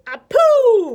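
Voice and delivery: loud, high blasting sneeze; fish's explosive sneeze; big sneeze. A narrator's reading. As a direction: sneeze it out hard. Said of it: "the great fish sneezed".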